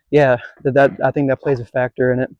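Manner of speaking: ordinary talk, nothing marked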